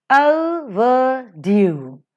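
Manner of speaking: "overdue" drawn out very slowly; slow teaching demo of "overdue", each syllable held. Shown as a demonstration. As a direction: stretch "overdue" into o, ver, DUE. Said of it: "O is unstressed".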